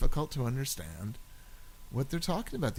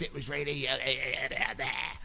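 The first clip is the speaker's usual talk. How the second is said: mock Cockney gabble trailing into nonsense sounds; 4 dB quieter than usual. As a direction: caricature an unintelligible Cockney speaker. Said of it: "But when she talked".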